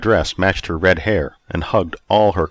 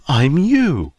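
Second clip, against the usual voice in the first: higher, playful seductress voice; enticing woman; 9 semitones higher than usual. A narrator's reading. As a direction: coyly reveal the secret. Roberta reveals who she is.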